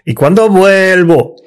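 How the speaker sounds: mocking his stretched vuelvo; imitating the slow take. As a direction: drag it like Auron does. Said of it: "Y tú haces".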